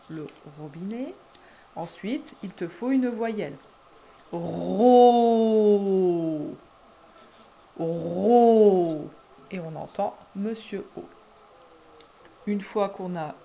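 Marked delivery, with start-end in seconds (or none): 4.3-9.1 "Ro" very loud and long, the O held out; insisted syllable sound. Shown as a demonstration. Helping a child find the vowel, "Ro" drawn out. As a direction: stretch "Ro" and dwell on the vowel. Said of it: "n'hésitez pas à insister".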